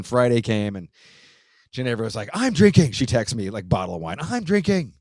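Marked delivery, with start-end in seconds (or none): 2.3-2.9 high, loud mock declaration; retold excited announcement. Imitates wife announcing she will drink. 4.2-4.9 high-pitched imitation of a gleeful text; retold excited announcement. Repeats wife's texted announcement.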